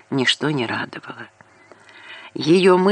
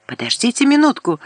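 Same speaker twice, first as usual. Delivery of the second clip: higher, fast frantic plea; 9 semitones higher, 3 dB louder, faster than usual. A narrator's reading. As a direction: blurt it out desperately. Frightened woman calling out.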